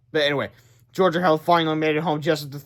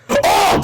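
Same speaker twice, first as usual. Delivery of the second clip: loud breathy yelp; pained cry; 9 dB louder than usual. Acted. Villain bitten by creatures, loud yelp. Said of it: "cried the Turbo Titan".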